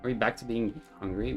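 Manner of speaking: ordinary delivery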